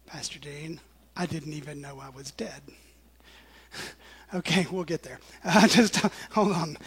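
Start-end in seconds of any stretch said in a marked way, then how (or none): 0.1-2.6 whispered, as the listener's thought; whispered quote. Pretend. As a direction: breathe it out, a playful aside. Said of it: "I know what you might be thinking".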